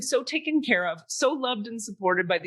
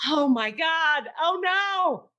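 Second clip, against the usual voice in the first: mock panic; 7 semitones higher, slower than usual. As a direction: panic as you pull up. Dread driving up to house.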